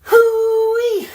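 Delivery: delighted high hollering whoop; excited whoop. Real, not acted. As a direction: whoop it out like you just got pranked. Excited whoop after April Fools joke.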